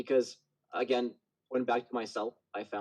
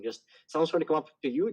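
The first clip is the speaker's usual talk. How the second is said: normal talking voice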